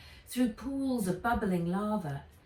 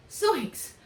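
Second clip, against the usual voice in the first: high, loud, slow relieved cry; relieved exclamation; 9 semitones higher, 6 dB louder, much slower than usual. A narrator's reading. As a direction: burst out in relief. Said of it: "she said".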